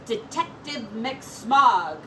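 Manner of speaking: usual speaking voice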